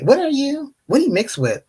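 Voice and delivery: high-pitched mock interrogation; rude questioner. Strangers interviewing mixed people, mock rude. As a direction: mimic nosy people, pitched well up. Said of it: "interviewed by people in a very rude way".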